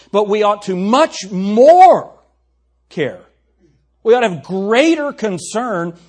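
loud, slow, drawn-out New York-free emphasis; forceful preaching. Real, not acted.

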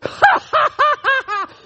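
high shouted evil cackle; mocking laugh. Preacher cackling at sinners in hell.

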